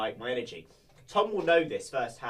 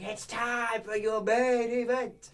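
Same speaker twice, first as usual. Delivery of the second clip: much higher, very slow drawn-out announcement; ring announcer; 10 semitones higher, much slower than usual. Retold or imitated. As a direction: stretch every word like a boxing ring announcer. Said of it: "There I was going".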